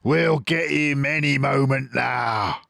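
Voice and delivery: a greedy farmer egging on his men; Boggis shouting. A narrator's reading. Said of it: "the fat Boggis shouted".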